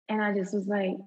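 normal talking voice

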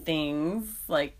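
ordinary delivery

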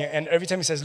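ordinary talk, nothing marked

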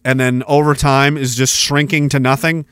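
normal talking voice

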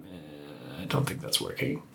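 very low, hushed reluctant murmur; understated, low mumbled disapproval; quiet, doubtful verdict. Retold or imitated. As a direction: let it out softly, almost voiceless. Voices director's quiet verdict.

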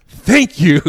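loud, high mock call across a room. Lady from other side of theater backing the dude, loud call. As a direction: call it out like a grateful heckler.

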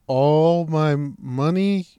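slow, drawn-out disbelief; incredulous question. Retold or imitated. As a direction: stretch it out as a stunned question. Robbery victim questions the demand.

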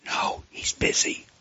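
put-on slow, breathy little-girl whisper; child's whisper. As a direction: hush it like a kid hiding. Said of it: "came the whisper".

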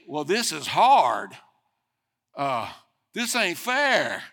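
slow, loud self-pitying groan of a thought; retold complaint. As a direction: whine it out loud like it's unfair. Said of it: "I'm beginning to think".

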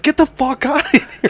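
very high-pitched retold yell; mock yell. Teens kicking out a couple making out, mock yelling. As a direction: imitate yelling someone out, pitch way up.

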